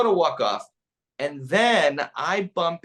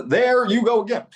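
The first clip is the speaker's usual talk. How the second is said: louder, higher put-on politician voice; political impression; 6 semitones higher, 6 dB louder than usual. Comic showing his impressions to the reporter, put-on presidential voice. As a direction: toss off a quick presidential catchphrase. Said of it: "I do a little bit of that and this".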